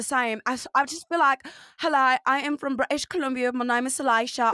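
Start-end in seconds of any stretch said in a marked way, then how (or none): 1.8-4.5 hammed-up, muddled British; bad British accent. Shown as a demonstration. Comic showing off their worst dialect, badly faked British accent. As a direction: attempt royal British and miss badly. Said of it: "I could do royal British or Cockney".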